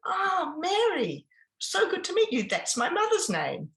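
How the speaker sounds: beam at her like a showman working the crowd; charming seminar host. Retold or imitated. Seminar speaker charming an audience member.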